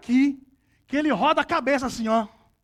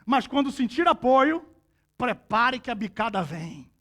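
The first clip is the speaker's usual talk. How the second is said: usual speaking voice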